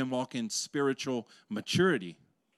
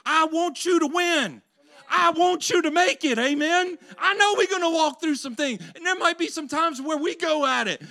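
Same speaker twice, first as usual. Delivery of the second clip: high, loud preaching climb; preaching at full force; 12 semitones higher, 5 dB louder than usual. Acted. Preacher rallying his congregation in a passionate, loud preach.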